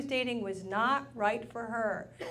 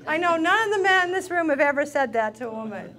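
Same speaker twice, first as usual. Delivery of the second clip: ordinary talk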